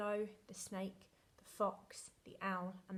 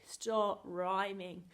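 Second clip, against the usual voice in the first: very slow, firm, louder mock order; drawn-out pretend telling-off; imitating exasperated mum; 5 dB louder, much slower than usual. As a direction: space out each word like a fed-up mum. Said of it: "now she's like".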